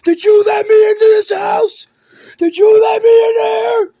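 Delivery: confused sleepwalker yelling on waking; furious shout. Retold or imitated. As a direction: bellow at the person who let you in. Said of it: "He's gonna wake up and be like".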